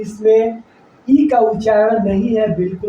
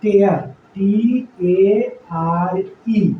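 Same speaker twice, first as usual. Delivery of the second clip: slow, spelled out टी ए आर ई; slow dictation; slow spelling; 2 semitones lower, much slower than usual. Shown as a demonstration. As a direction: spell tier out slowly letter by letter. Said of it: "अब हमने लिया".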